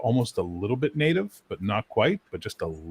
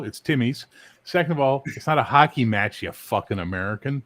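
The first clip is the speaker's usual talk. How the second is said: usual speaking voice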